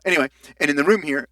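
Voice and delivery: normal talking voice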